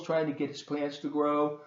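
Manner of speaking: plain reading voice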